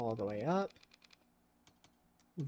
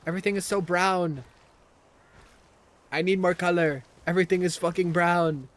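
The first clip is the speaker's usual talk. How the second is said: loud, emphatic mock outrage; exasperated complaint; 4 semitones higher, 9 dB louder than usual. Real, not acted. Streamer griping about the game's drab colors, loudly exasperated. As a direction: belt out the grievance.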